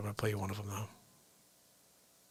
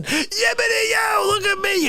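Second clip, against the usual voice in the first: goofy yell; 12 semitones higher than usual. Pretend. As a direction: yell it out like a castaway waving for rescue. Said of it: "you're yelling".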